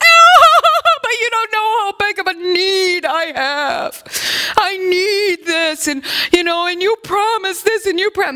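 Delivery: high, whiny mock crying plea; mock whining. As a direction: ham up a sobbing beggar on the floor. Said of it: "throw myself on the bank floor".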